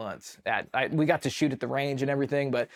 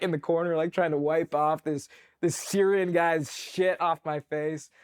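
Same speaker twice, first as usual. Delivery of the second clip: ordinary talk, nothing marked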